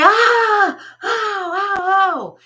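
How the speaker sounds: sharp high yelps of pain, ow ow ow; janitor yelping in pain. Retold or imitated. Janitor crying out as the chair hits his hand, high yelps. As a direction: cry out as the chair slams your hand.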